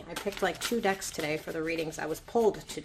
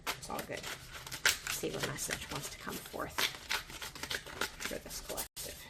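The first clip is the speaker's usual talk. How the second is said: whispered; 3 semitones lower, 6 dB quieter than usual. Real, not acted. Quiet murmur while shuffling cards.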